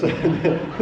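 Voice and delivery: loud, short amused laugh. Real, not acted. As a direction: break into laughter mid-story. Laughs while telling the story.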